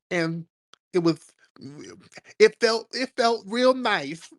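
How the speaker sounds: high, dreamy, savouring swoon; camp Andrew Caldwell impression. Mocking the delivered guy describing sex, very high and dreamy. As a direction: draw it out like he's swooning. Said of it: "That sounds like him".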